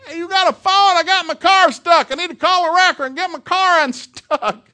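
high, loud holler across a field; yelling to someone far off. Retold or imitated. Stranded coach hollers to a farmer for help, yelling. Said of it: "And he yells at him".

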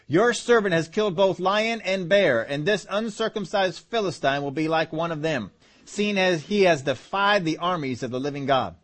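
measured, steady Bible reading; scripture reading. Acted. Preacher reading Saul and David to the congregation. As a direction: read the verses to the church.

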